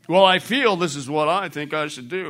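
mock someone trusting their feelings; mock feelings talk. Preacher mocking a congregant following their feelings.

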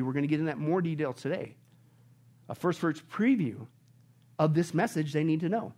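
ordinary talk, nothing marked

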